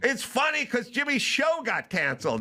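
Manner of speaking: high, exasperated explanation. Retold or imitated. Telling Mike the show is canceled, high exasperation. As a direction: spell it out high, fed up. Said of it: "And I go".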